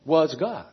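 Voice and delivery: "Was" hit, "God" quiet and falling; stress on was. Shown as a demonstration. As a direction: put the weight on "Was", let "God" drop softly. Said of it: "now this group is saying the Word was a God".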